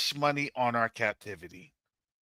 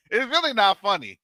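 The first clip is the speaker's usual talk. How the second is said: ordinary talk, nothing marked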